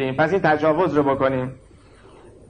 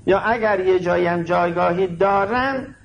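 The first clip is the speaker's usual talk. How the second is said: ordinary delivery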